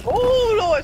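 sudden high loud oh; startled exclamation; surprised shout. Real, not acted.

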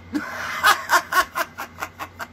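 mocking laugh, pitched high; high breathy laugh. Real, not acted. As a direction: let out a scornful giggle. Laughing at his own insult.

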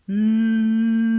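a loud, sustained nasal "Mm" hum; bear hum. Shown as a demonstration. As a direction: hum "Mm" like a purring bear cub. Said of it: "Próbujcie to jeszcze raz".

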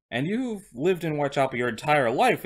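ordinary delivery, nothing marked

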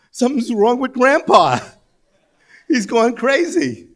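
put-on high, loud child's voice, alarmed; a granddaughter crying out about grandpa; upset granddaughter. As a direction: play the scared little girl telling grandma.

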